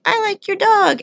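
high-pitched imitation of a little girl. Little girl on a bike to a dog walker, high child voice. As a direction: quote her in a high, child-like voice.

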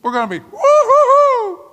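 very high, loud woo-hoo-hoo; gleeful whoop. Acted. Preacher riding up to heaven, loud high hooting. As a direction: hoot like on a rollercoaster. Rapture ride thrill.

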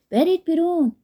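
high, loud warning yell; urgent shout. A narrator's reading. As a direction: shout it to get everyone out. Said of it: "زوربا بار دیگه فریاد کشید".

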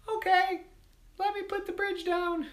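very high, tiny squeaky voice; little voice. Acted. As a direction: answer in a teeny high pitch. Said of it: "the little voice said".

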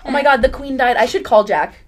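shocked loud realization; shocked outburst. Real, not acted. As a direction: blurt it out in disbelief. Repeats the news louder.

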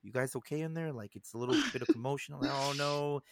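low, soft, drowsy; sleepy voice. Retold or imitated. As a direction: sound half asleep at the door. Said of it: "I'm acting fucking sleepy".

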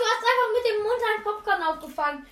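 high, loud and fast; amazed. Real, not acted. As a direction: gush in disbelief. Amazed at popcorn catch.

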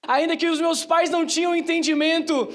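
ordinary talk, nothing marked

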